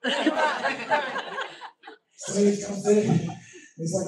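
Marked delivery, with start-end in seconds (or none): 0.0-1.1 higher-pitched mock exasperation; exasperated outburst. Pastor showing his own reaction to bad news, mock exasperation. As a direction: re-enact a frustrated cry upward.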